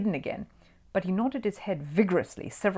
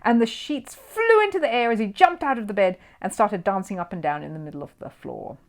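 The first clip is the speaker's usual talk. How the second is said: usual speaking voice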